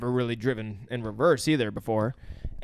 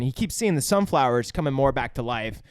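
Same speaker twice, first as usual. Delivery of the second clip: normal talking voice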